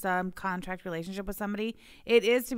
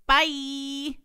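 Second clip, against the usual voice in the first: a high, louder, snotty little bye; snide mock goodbye; 6 semitones higher, 4 dB louder than usual. Retelling the rival's message, a mock snotty bye. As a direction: sign off with a smug flick.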